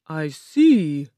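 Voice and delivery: loud, imposing statement; booming Oz. A narrator's reading. Oz credits Dorothy with the killing, booming. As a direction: boom it out with heavy stresses.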